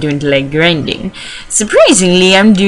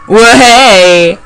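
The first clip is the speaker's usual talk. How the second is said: louder drawn-out whoop; exclaimed cheer; 3 semitones higher, 6 dB louder than usual. Acted. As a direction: call out a big hooray. Mock cheer after fast-forwarding.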